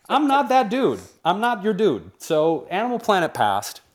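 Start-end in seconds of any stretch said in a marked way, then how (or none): 0.1-2.1 high, loud comic refusal; indignant retort. Retold or imitated. Host retelling his rebuff of the network's lumberjack demand, indignant. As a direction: push back in mock indignation.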